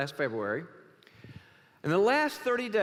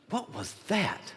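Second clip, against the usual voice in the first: mock-startled burglar, a touch of alarm; lightly acted jumpy question; mildly startled; 3 semitones higher, 3 dB quieter than usual. Burglar hearing a voice in the dark, mildly startled.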